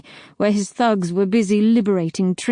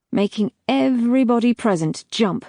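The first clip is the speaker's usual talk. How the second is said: ordinary delivery, nothing marked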